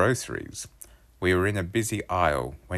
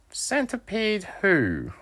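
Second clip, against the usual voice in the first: slow joke reply; slower than usual. A narrator's reading. Knock-knock reply.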